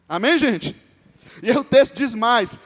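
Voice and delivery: normal talking voice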